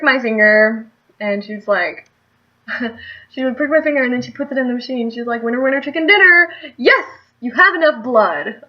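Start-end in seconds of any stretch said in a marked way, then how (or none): 5.4-8.6 high, loud imitation of a playful nurse; mock celebratory announcement. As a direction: announce a jackpot, laughing through it. Imitates nurse announcing good result.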